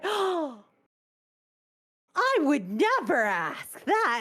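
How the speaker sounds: high, slow mock-offended mother voice; scandalized mom. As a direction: play the shocked mother, drawn out and high.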